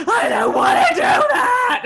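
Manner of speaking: very high, loud, drawn-out tantrum shriek; slow, screaming whiny refusal; mock child wail. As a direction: imitate a child howling in protest. Imitates son refusing at dinner.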